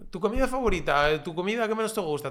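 mock interviewer. Parodying podcast hosts, a lightly mocking interviewer. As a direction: rattle off the tired stock questions. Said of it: "En plan".

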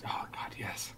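fervent whispered yes; whispering. Real, not acted. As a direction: whisper back eagerly. Whispered agreement to the aside.

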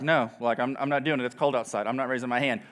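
low, soft mock refusal; that reluctant guy. Audience member refusing to join the test, low grumbling. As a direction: play an unwilling grump, understated. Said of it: "don't be that guy".

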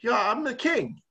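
a hint of a haughty king proclaiming himself; mock royal announcement; pompous king. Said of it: "when he's like".

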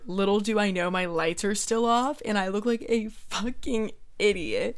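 ordinary talk, nothing marked

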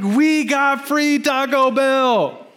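high, slow, stretched proud announcement; drawn-out excitement. Retold or imitated. Son telling his parents about the free lunch, drawn-out excitement. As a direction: savour every word of the good news.

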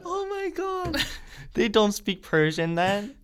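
high-pitched, stunned outburst; shocked disbelief. Real, not acted. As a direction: gasp it out in disbelief. Shocked at Persia mistake.

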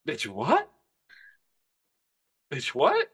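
half-joking stunned bitch what; incredulous bitch what, climbing high. Retold or imitated. Mock reaction to her reply.

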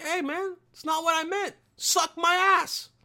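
a hammed-up fake apology, slow at the end; mock dismissive voice. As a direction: toss it off as a smug jerk. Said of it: "be like".

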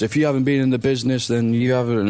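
a hint of a sneering insider; lightly lampoon the insider brush-off; mock wrestler scolding. Fan quotes wrestler Trevor Lee's tweet, lightly sneering parody. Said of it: "he said one of those".

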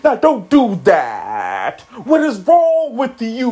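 slow, exaggerated, endings stretched out; hammed-up imitation of a slurring talker; slurred-ending impression. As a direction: drag each last word, swooping in pitch. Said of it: "end his words with kinda like a slur".

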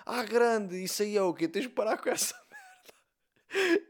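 high, loud, drawn-out mock scolding; Irina imitation. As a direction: imitate an indignant girlfriend, high and slow. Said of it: "E a Irina disse". (imitação exagerada de namorada indignada)

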